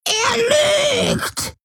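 put-on piercing, very high shriek of an old hag; shrieking crone. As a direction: screech the accusation like a crazed old woman. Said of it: "kreischte die Alte".

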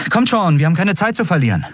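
high, hurried urging. A narrator's reading. Endriel to her companions after her embarrassing slip, hurriedly urging on. Hurrying the others along.

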